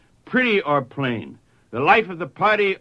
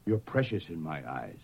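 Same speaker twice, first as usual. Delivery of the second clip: soft voice of God; 10 semitones lower, 10 dB quieter than usual. Acted. Preacher voicing God's love.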